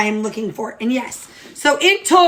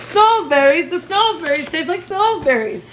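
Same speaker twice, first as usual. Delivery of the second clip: quoting a movie line; 3 semitones higher than usual. Retold or imitated. Baker quizzing viewers on a movie, playful quoted voice. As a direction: put on a movie character's line for fun. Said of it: "Name that movie".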